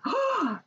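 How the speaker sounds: sharp high gasp of surprise. Acted. Reading picture book to kids.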